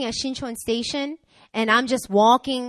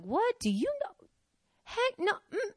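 high-pitched, softer huff; indignant sass, acted; 11 semitones higher, 6 dB quieter than usual. Woman whose pride was alive, high indignant muttering. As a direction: huff it high, mock offended. Mimes indignant thoughts before walking back.

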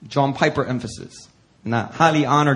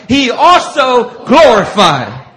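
slow, much louder, higher final line; preaching climax; 9 semitones higher, 10 dB louder, much slower than usual. Acted. Preacher crowning the verse for the congregation, slow and loud. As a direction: land the last word like a shout of praise.

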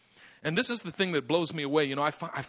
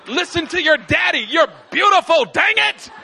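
higher, louder exasperated daddy shout; loving hammed-up yell at daughter; mock shout; 11 semitones higher, 12 dB louder than usual. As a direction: shake her with your voice. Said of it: "just shake her".

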